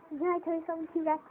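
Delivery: ordinary talk, nothing marked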